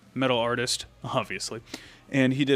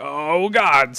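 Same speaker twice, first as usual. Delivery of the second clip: mock dismay; 4 semitones higher, 9 dB louder than usual. Retells reaction to sudden snowstorm.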